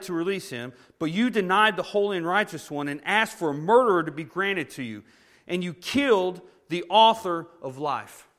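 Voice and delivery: loud, declaiming scripture to the crowd; preacher reading scripture with force; scripture read aloud. Acted. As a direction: proclaim Peter's words with weight.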